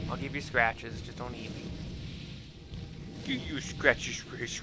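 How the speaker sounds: usual speaking voice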